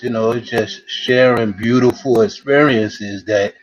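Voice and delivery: ordinary talk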